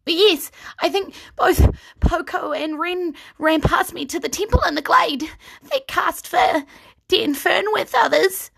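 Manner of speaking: high gnome voice, breathless; gnome Rusty. Acted. Rusty answers Kyra.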